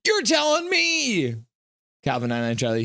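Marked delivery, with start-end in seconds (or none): 0.1-1.3 hammed-up you're telling me; exaggerated agreement. Real, not acted. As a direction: stretch it out in mock outrage. Mock agreement with chat.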